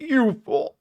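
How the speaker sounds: high shouted "You fool!"; catchphrase shout. Shown as a demonstration. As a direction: fling it up high like a villain. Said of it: "I had many ways of saying it".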